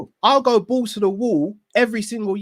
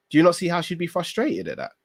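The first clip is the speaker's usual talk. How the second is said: ordinary talk, nothing marked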